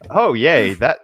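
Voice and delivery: usual speaking voice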